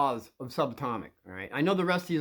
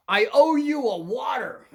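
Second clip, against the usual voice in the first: high, loud and slow across the room; louder and higher, slow and bold; loud call; 9 semitones higher, 7 dB louder than usual. Pretend. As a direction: call out boldly to the big guy. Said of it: "you're gonna say".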